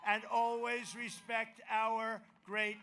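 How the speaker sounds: loud, rousing campaign promises; rally the crowd with conviction; rally speech. Acted.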